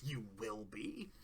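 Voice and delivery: sinister reply. Retold or imitated.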